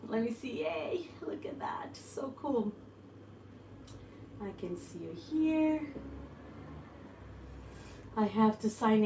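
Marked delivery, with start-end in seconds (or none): 0.1-5.8 soft whispered delight. Real, not acted. Whispering to her live viewers as she checks the screen. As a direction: hush it right down, breathy and excited.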